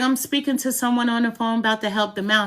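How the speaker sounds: quick daughter reply. Retold or imitated.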